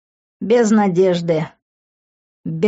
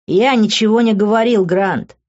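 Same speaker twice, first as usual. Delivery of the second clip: ordinary delivery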